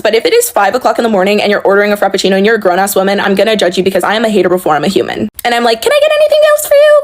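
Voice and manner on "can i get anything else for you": very high, rushed, over-sweet service voice; annoying customer service voice. Acted. Barista to a customer at the window, annoying customer service voice. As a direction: chirp it fast in a super annoying service voice. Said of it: "in my super annoying customer service voice".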